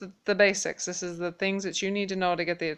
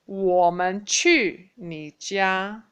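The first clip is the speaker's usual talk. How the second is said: 我们去你家 said very slowly and louder; slow loud model sentence; slow review drill; 4 dB louder, much slower than usual. Shown as a demonstration. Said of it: "let's review again".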